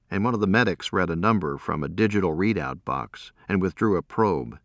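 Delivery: ordinary delivery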